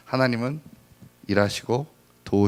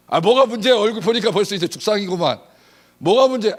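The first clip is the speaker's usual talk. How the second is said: loud, high what's the problem; husband presses his wife, loud and high; rich husband asking; 10 semitones higher, 7 dB louder than usual. Acted. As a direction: demand it with booming generosity. Husband demands what's wrong.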